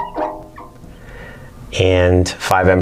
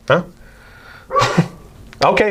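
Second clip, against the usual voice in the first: usual speaking voice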